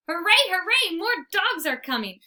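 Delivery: high, loud joyful hooray; bursting with happy excitement; excited cheer. A narrator's reading. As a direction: cheer like a thrilled puppy. Dog hears more dogs.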